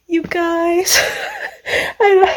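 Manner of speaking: high, teary laugh-cry; overwhelmed, laughing through happy tears; tearful. Real, not acted. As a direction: choke up and giggle, pitched high. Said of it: "I'm crying".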